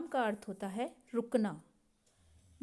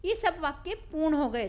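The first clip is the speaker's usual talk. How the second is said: ordinary talk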